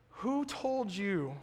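ordinary delivery

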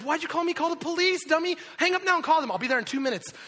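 high, loud frantic orders; husband shouts down the phone at his scared wife; urgent shout. Retold or imitated. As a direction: yell at her to call the police. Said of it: "I'm like".

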